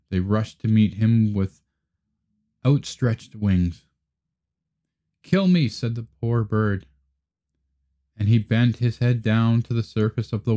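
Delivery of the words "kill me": high, despairing plea; pleading duckling. Acted. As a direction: beg to be killed. Swan-duckling begs death.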